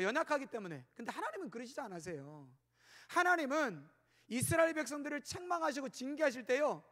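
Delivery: ordinary talk, nothing marked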